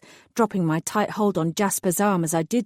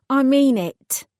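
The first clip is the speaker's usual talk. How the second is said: firm; 7 semitones higher, 6 dB louder, slower than usual. A narrator's reading. Firm demand, hands on hips.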